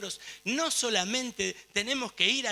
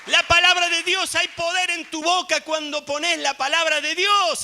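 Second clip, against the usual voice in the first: loud, high, full-force exhortation; urgent, ringing proclamation; impassioned preaching; 7 semitones higher, 9 dB louder than usual. Real, not acted. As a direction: preach it at the top of your voice.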